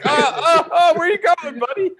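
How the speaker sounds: high, pleading voice to a shrinking body part; high mock-desperate calling out. Friend mocking Andrew chasing his own body part, high mock pleading. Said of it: "You'd be like".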